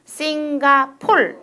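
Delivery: 싱가폴 slowly, ending on a firm L; Korean final L. Shown as a demonstration. Teacher showing how Koreans say Singapore, 싱가폴 with final L. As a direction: end 싱가폴 on L, no R. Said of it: "L로 발음하는 거예요".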